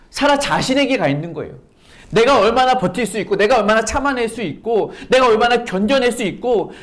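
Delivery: ordinary talk, nothing marked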